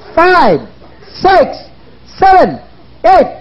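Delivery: high, punchy count building to a shout. Real, not acted. Seminar speaker cueing crowd to shout declaration five times, shouted count-in. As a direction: count them in with rising force.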